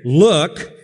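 disdainful. Retold or imitated. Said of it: "Complete disdain, complete disrespect".

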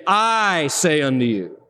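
slow, weighty "I" in "I say unto you"; authoritative stress on I. Shown as a demonstration. Preacher voicing Jesus' claim of authority, stress on "I". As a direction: lean hard on "I". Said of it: "intensifying the fact that he speaks authoritatively".

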